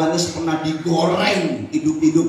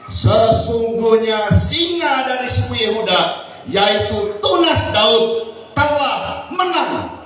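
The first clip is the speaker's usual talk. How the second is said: higher and louder, slowing to a proclaiming climax; preaching building up; 8 semitones higher, 4 dB louder, slower than usual. A narrator's reading. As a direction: proclaim the verse, rising to a triumphant close. Reading verse with rising force. (membaca ayat dengan suara berkhotbah yang makin lantang)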